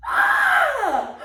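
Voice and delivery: high delighted shriek then laugh; excited shriek. Real, not acted. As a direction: squeal with joy and laugh. Seeing the signature.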